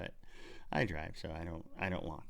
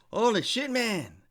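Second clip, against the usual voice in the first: high-pitched, loud, amazed bystander voice; excited bystander; 12 semitones higher, 6 dB louder than usual. Retold or imitated. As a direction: play the wide-eyed guy who saw it all.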